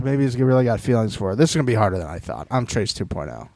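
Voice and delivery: low, fast mock inner monologue; mocking Trace. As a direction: rattle it off low and quick as a scheming rival. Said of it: "He should be like".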